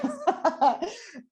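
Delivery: high, emotional laugh through tears; a real choked-up laugh; teary laugh. Real, not acted. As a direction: laugh to keep from crying. Emotional laugh before answering.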